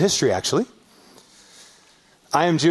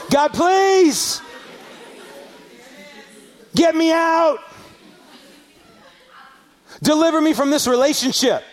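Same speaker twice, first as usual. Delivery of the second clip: desperate cry; 10 semitones higher than usual. Acted. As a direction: cry out begging to be freed. Desperate prayer, acted out.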